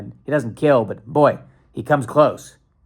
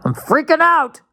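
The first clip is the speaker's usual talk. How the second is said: much higher and louder hammed-up panic; mock panic shriek; 14 semitones higher, 5 dB louder, slower than usual. Host hams up panic over his word slip, shrieking. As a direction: shriek it like a joke meltdown. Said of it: "Oh God, I'm getting old".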